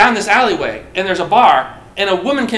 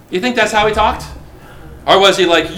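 ordinary delivery

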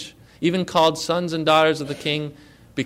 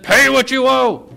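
angry demand; 7 semitones higher, 8 dB louder than usual. Acted. Servant seizes debtor by throat.

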